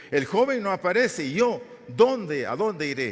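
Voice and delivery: high, loud despairing outcry; desperate cry. Acted. Rubén tells his brothers the boy is gone, desperate loud cry. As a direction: cry out in panic, stretching the question. Rubén finds the cistern empty.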